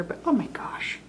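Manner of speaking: awed whisper. Real, not acted. Admiring the cut soap.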